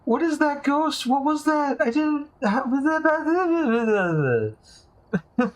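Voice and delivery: high, slow baffled questions; stunned disbelief. Real, not acted. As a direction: drag it out in disbelief. Stunned after losing.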